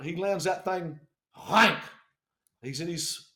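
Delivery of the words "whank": one sudden loud whank; imitated landing thunk. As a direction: punch out the landing noise. Sound of the helicopter landing.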